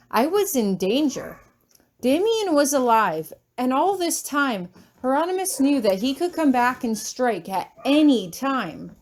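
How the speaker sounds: plain reading voice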